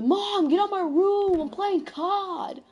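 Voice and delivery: high, slow whiny nerd voice; whiny nerdy kid. Shown as a demonstration. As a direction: whine like an annoyed gamer kid. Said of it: "I'm gonna be using the".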